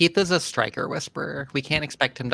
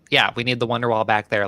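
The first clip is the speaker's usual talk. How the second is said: ordinary delivery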